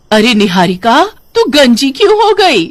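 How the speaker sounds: loud, high-rising astonished mother; shocked question. Acted. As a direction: exclaim in shock at her bald daughter. Mother finds daughter bald at night. (चौंकी हुई माँ)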